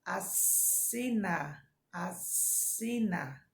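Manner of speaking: "assina" said slowly with a long hissed ss; hissing S. Shown as a demonstration. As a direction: draw out the ss in "assina" like a snake. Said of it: "Tá vendo a diferença? Da ... da cobra é s".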